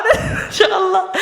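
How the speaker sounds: high-pitched words breaking into laughter; real giggles running through the speech; laughing. Real, not acted. Preacher tells of reciting while laughing, cracking up himself. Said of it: "Sambil ketawa".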